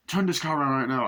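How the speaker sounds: firm order. Retold or imitated. Doctor telling the driver to turn the car, firm retold order. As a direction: give a firm first order, mildly acted.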